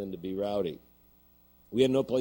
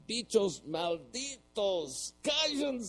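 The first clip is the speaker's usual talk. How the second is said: high, drawn-out Spanish yell; top-of-voice scream; 12 semitones higher, much slower than usual. Retold or imitated. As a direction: scream it at the dogs, imitating a fed-up friend. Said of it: "screams at the top of his voice".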